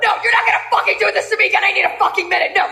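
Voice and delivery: hysterical rant with high-pitched outbursts; screaming meltdown. Acted. Sister fights to keep the family together, hysterical yelling. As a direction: rant frantically, breaking into shrieks.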